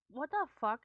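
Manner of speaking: sudden loud what the fuck, caught off guard; real startled outburst. Real, not acted. Streamer shocked to find his edit unfinished, loud startled outburst. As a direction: blurt it out as the edit goes wrong.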